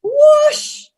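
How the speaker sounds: high, loud swooshing cry; loud whoosh. Retold or imitated. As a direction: swing it hard out loud. Grandma swings cudgel sound again.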